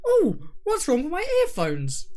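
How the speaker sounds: high, puzzled voice; confused listener. Retold or imitated. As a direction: wonder aloud, baffled. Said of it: "Making all of the audience think".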